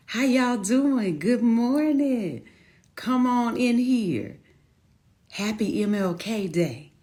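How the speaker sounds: high, slow, drawn-out greeting; sing-song welcome. Real, not acted. Host welcomes arriving viewers.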